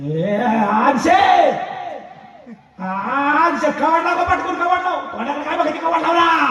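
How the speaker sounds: high calling shout; calling wife. Acted. Husband calls wife to shut door, high shout. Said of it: "बायकोला आवाज देतो".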